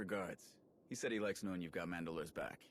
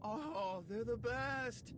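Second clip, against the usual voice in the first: much higher, slow, squealing delight; gushing; 13 semitones higher, much slower than usual. Acted. Ras gushes about strills to the team, excited. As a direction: melt with joy over the animal.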